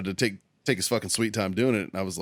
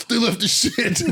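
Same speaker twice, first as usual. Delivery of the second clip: laughing quote; 12 semitones higher, 3 dB louder than usual. Retold or imitated. Roommate quoted amid laughter.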